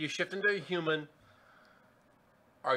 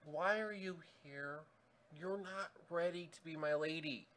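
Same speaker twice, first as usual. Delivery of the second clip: softer, gentle questioning lady; soft Alice voice; 9 dB quieter than usual. Acted.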